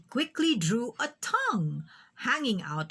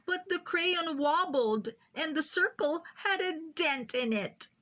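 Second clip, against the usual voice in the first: ordinary delivery, nothing marked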